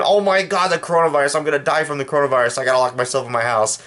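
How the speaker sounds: mocking a burger-eating man panicking over the virus; mock panic. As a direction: squeal like a scared glutton. Same mocked man again.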